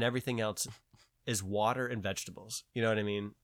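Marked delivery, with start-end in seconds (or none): none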